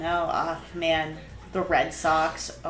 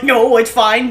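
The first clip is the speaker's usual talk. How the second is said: stubborn refusal; 5 semitones higher, 14 dB louder than usual. Retold or imitated. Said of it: "you're like".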